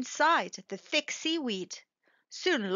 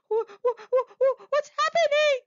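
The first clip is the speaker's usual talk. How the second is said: high frightened stammer, getting louder; stuttering; 14 semitones higher, 5 dB louder than usual. Acted. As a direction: stumble over the words in panic. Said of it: "stuttered Turtle".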